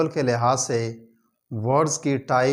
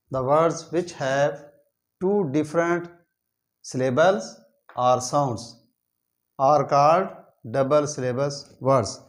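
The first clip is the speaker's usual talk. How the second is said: slow dictation; much slower than usual. Shown as a demonstration. Reads English definition slowly.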